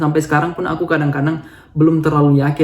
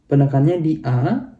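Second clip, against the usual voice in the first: usual speaking voice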